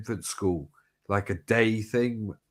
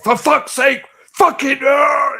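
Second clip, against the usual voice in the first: high, loud furious shout; furious father; 10 dB louder, slower than usual. Retold or imitated. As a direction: blow up like the maniac in the works. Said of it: "he'd be like that, going fucking mad".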